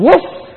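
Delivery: dog bark. Acted. Dog answers butcher again.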